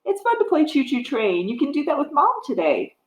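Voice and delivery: ordinary talk, nothing marked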